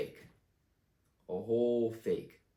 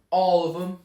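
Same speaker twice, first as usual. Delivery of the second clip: cocky shout; 6 semitones higher, 7 dB louder than usual. Pretend. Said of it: "I want them to say".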